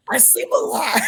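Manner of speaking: laughing through the words. Real, not acted. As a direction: answer while laughing, pitched high. Laughing at the sleep question.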